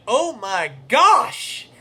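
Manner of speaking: loud, slow, stretched-out gasp of recognition; surprised exclamation. Retold or imitated. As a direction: blurt it out like you just recognised an old friend. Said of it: "I heard his voice and I went".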